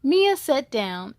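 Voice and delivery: usual speaking voice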